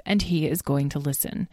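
plain reading voice

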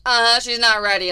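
put-on snarky teen. Teen brushing off mom's dates.

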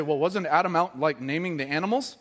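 skeptical objector. Retold or imitated. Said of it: "I've heard people say".

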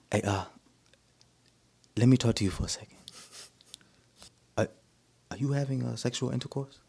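put-on lightly done West African accent; dad's question in a soft African accent. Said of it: "I'm gonna try the African accent".

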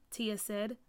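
ordinary delivery, nothing marked

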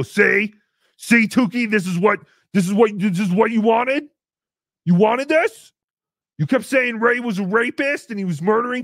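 mock-outraged blaming; accusing rant. Imagined people blaming Tukey.

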